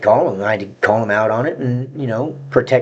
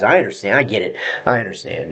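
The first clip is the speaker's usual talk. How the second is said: ordinary delivery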